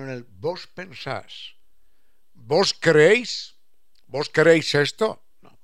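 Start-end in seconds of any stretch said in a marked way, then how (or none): 2.3-5.2 archaic vos. Pretend. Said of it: "terminó degradado".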